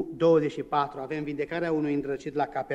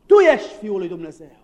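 demons shouting; 9 dB louder than usual. Retold or imitated.

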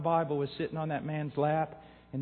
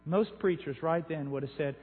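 ordinary delivery

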